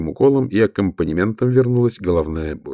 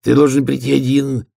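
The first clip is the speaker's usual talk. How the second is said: put-on young troll voice; 6 semitones higher than usual. Young troll messenger speaks.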